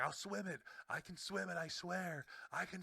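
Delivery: high-pitched earnest protest; imitated kid insisting. Retelling child insisting desperately.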